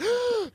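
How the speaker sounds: high sharp gasp of shock; imitated gasp of surprise; mock gasp. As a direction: gasp like hearing big news. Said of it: "she was like".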